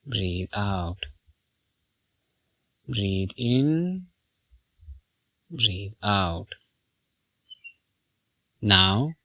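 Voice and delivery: soft, low meditation voice; guided meditation. Acted. Teacher guiding listeners to breathe in and out, guided meditation. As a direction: talk them gently through each breath, leaving long pauses.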